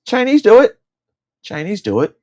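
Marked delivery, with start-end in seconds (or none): 0.1-0.8 high, loud blurt of the punchline; loud exclamation. Acted. Ranting about eating cats, shouting it loud and high.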